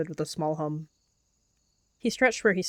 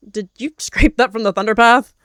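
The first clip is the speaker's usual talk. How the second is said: scornful; 4 semitones higher, 13 dB louder than usual. A narrator's reading. Suspicious warrior mocks apprentice.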